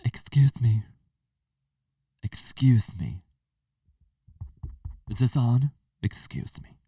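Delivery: hammed-up announcement with whispered excuse-me's; mock dramatic quitter. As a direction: parody a self-important goodbye post. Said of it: "the people who are like".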